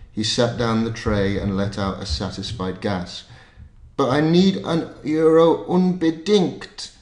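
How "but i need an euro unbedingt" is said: put-on high, slow Scottish accent. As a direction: insist slowly in a Scottish accent. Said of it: "I'm gonna do a Scottish accent".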